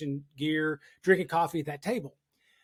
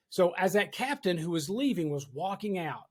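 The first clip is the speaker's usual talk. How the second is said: ordinary delivery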